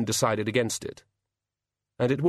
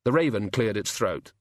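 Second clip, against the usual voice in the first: plain reading voice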